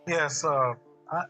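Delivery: usual speaking voice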